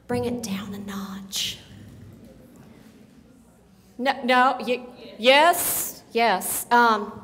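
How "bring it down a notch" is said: whispered. Retold or imitated.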